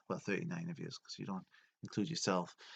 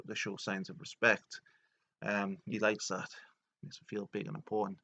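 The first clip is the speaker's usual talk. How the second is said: ordinary delivery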